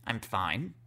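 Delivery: low, soft, tight-lipped fine; pursed-lip fine. Retold or imitated. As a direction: pout the words out, quietly hurt. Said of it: "purses her lips and says".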